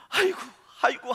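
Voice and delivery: high mock wail, crying out; wailing aigo. Dreamer waking from the dream in tears, high wail. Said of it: "눈물을 막 흘리면서".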